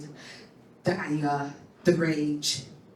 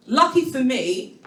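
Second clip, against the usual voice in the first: ordinary talk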